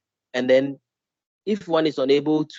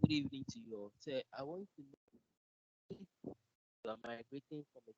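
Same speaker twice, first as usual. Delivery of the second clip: faint, far-off voice; very quiet, barely there; barely audible; 3 semitones higher, 21 dB quieter than usual. Real, not acted. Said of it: "Your voice is very low".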